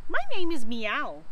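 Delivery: a hint of a cat's meow. A narrator's reading. Cat introducing himself to a ghost, lightly played.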